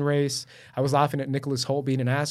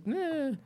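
soft, high-pitched noncommittal noise; underwhelmed "eh"; 12 semitones higher, 7 dB quieter than usual. Retold or imitated. Film fan let down after so much buildup, underwhelmed. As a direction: give a small high shrug of a sound.

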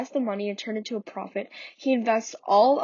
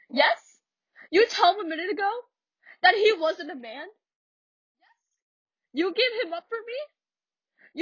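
higher, incredulous scolding mother; Mama reproaching daughter; 5 semitones higher than usual. Acted. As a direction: challenge the daughter's judgment. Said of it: "I'm not good at accents".

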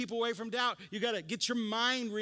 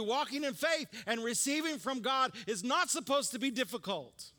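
preaching at full force; 3 semitones higher than usual. Acted. Preacher exhorting his congregation about faith, raised and ringing.

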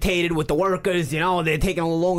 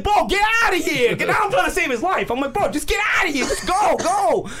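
urgent shout; 9 semitones higher than usual. Retold or imitated. Shooing the bleeding guy away.

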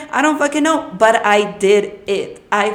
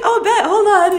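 much higher, bubbly receptionist; perky clerk answering, pitched way up; 10 semitones higher, 6 dB louder than usual. Retold or imitated. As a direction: play the cheerful front desk girl. Receptionist voiced high laughing.

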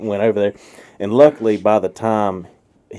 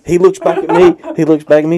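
ordinary talk

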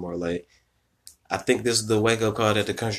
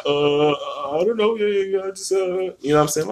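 imitated sheepish backpedaling, higher pitched; flustered friend; 10 semitones higher than usual. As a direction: play him caught out and stumbling, voice up high. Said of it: "then he like".

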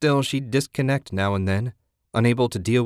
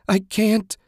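high, distressed moan; 12 semitones higher, 4 dB louder than usual. A narrator's reading. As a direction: moan helplessly in a raised pitch. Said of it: "she moaned".

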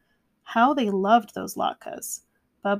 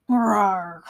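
growl; 5 dB louder than usual. A narrator's reading. Bear growls at the oven.

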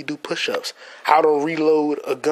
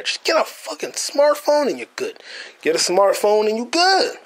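usual speaking voice

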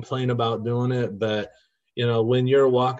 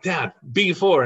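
put-on higher-pitched kid calling out to Dad; excited boy; 6 semitones higher, 4 dB louder than usual. Sick boy calls his Battleship move to his dad, excited. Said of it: "he'd be like".

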